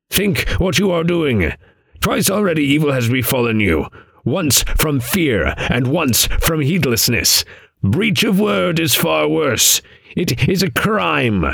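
high-pitched raven, admonishing; croaking raven. A narrator's reading. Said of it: "croaked the raven".

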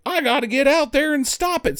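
high eager resolve; mock trainer. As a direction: rush in on a high voice. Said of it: "and he's like".

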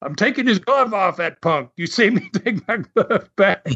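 high, cocky bragging with a laugh breaking in; mock Carson bragging. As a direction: crow about it like a smug heel. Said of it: "He goes".

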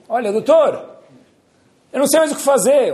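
high, loud desperate plea; desperate king. Acted. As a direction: beg the doctor, at wits' end. (rei desesperado implora ao médico, voz alta e aguda)

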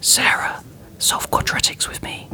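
mock creepy whisper; hushed creepy whisper. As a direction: breathe it creepily into the mic.

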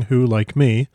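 plain reading voice

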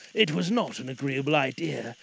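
plain reading voice

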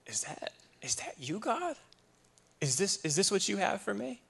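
high, hushed questioning; wondering prayer. Retold or imitated. Retelling a private question to God, tentative and wondering. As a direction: lift the voice high and ask tentatively.

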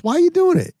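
imitating a wife's high-pitched questioning; wife's incredulous challenge. Wife questions husband going on the show, imitated in high protest. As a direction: pitch jumps up in mock protest.

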